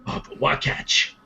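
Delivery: slow, hushed tribute; softly mourning with proud admiration; solemn condolence. Acted. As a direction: say it quietly, head bowed in respect. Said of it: "kind of put his head down".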